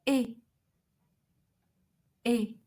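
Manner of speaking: é said as a tense "ay" sound with no glide; model French é. Shown as a demonstration. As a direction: say the é vowel for students to repeat. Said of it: "listen carefully and repeat".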